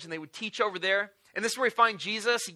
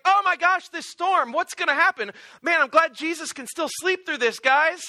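high, loud, hammed-up fake calm; mock casual hint; 8 semitones higher, 5 dB louder than usual. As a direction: talk loudly so Jesus wakes up. Disciples loudly hinting to wake Jesus.

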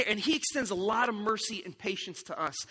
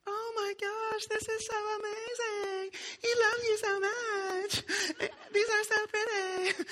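very high, slow, gushing coworker voice; gushing office ladies; 13 semitones higher, slower than usual. Retold or imitated. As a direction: squeal it like thrilled coworkers admiring roses. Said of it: "all the ladies who worked with her were like".

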